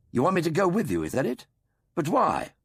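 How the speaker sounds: doctor puzzles at Grun's mime; puzzled questioning. A narrator's reading. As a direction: press for an answer, bewildered.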